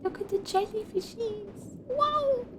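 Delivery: high gasping delight. Real, not acted. Gasps over jellyfish.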